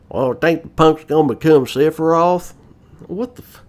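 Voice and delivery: high, mock Southern oil tycoon accent; Southern drawl parody. Mocking Cid's voice, overdone Southern accent. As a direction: ham up a cowboy Southern accent. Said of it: "sound like a ten-gallon hat wearing".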